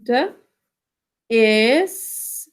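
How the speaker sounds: "D is" as separate sounds, duh, then stretched isss; sounded out. Shown as a demonstration. Teacher checks spelling with class, "D is" stretched phoneme by phoneme. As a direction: draw out "D is" sound by sound. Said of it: "Sound it out".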